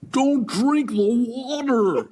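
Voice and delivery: high, slow put-on nurse voice. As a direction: drag it out like a bossy nurse. Nurse voiced in retold story.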